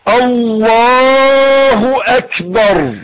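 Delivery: long slow chanted Allahu akbar; drawn-out takbir. Shown as a demonstration. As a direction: stretch God is greatest out like a call. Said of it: "قول معايا".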